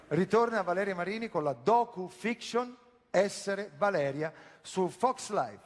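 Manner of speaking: normal talking voice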